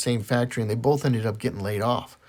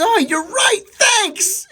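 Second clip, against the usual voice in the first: much higher, bright relieved thanks; 4 dB louder, much slower than usual. Acted. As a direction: squeak it out relieved. Johnny misunderstands, relieved.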